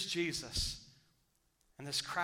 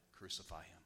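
hushed Crucify him; 8 semitones lower, 12 dB quieter than usual. Acted. Quoted cry in hushed sermon.

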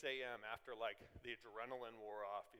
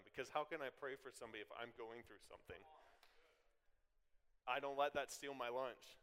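ordinary talk

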